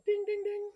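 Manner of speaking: high sung ding-ding-ding; little bell over the door ringing; imitated bell. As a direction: chime it like a shop bell.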